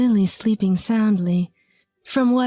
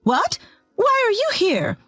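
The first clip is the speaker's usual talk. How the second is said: much higher, panicked squeak; 13 semitones higher than usual. A narrator's reading. Mother-in-law startled by her son's arrival, high panicked. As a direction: caught red-handed, panicking. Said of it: "Mother-in-law looked panicked".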